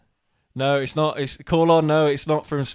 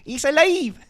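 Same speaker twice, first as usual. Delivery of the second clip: high, loud squeaky Mexican mouse voice; cartoon Mexican accent, pitched up; mock Mexican accent; 7 dB louder, slower than usual. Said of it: "You sound like the little mouse".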